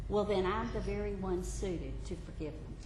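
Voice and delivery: Jesus gently reassuring the believer; gentle reassurance. Acted. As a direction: answer softly, without hurry.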